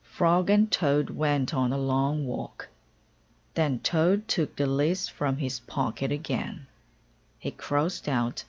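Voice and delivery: ordinary delivery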